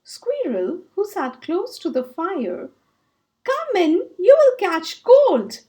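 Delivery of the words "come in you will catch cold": calling out. Acted. Squirrel calls Hare indoors.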